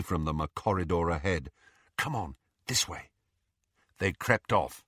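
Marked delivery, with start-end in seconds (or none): 2.0-3.1 soft, secretive urging; whispered. A narrator's reading. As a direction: hush it as you lead on. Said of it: "he whispered".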